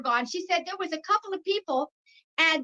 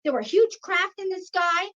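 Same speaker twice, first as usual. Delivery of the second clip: ordinary delivery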